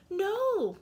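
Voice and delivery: high, loud cry of "No"; old lady cries out to the policeman; cried out. Acted. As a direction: cry out to stop him. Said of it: "cried the old lady".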